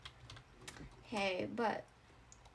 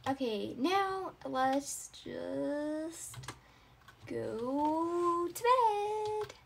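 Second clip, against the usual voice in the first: very slow; 2 semitones higher, much slower than usual. Acted. Drawn-out sleepy line.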